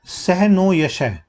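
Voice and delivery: सह नो यशः spaced out, word by word; slow quoted tagline. Shown as a demonstration. Teacher dictating the tagline slowly to students. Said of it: "tagline क्या थी?".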